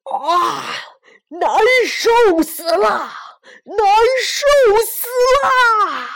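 loud, slow anguished yell; pained shout. Acted. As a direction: bellow in pain, stamping. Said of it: "难过得直跳脚".

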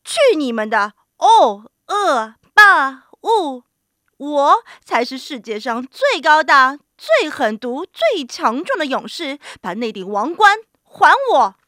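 loud, bullying giant; loud, domineering storybook giant; giant's shout. A narrator's reading. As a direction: boom out boasts, hitting each one hard. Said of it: "对他们三个人喊".

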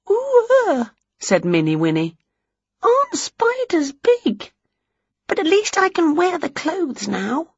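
very high, small Mini Winnie; tiny witch voice. Acted. Mini Winnie marvels at big spiders in a tiny high voice. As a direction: shrink the voice to teaspoon size.

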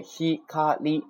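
ひかり slow and high, each kana separate. Shown as a demonstration. Teacher shows what forms the word, ひかり syllable by syllable. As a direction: break ひかり into three even beats. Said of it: "构成".

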